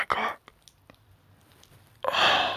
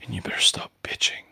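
whispered, clipped and strained; tense whisper; 5 semitones higher than usual. Acted. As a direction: whisper the threat back under your breath.

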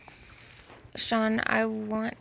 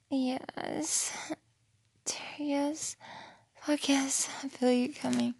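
whisper; 4 semitones higher, slower than usual. Real, not acted. Whispered yes.

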